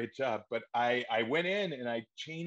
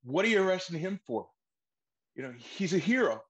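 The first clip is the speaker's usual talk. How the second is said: higher, louder indignant protest; audition outburst; 7 semitones higher, 4 dB louder than usual. Acted. Actor auditioning for director, higher indignant protest. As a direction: plead the hero's case hard. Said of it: "I was like".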